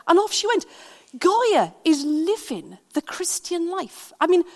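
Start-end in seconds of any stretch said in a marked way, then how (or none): none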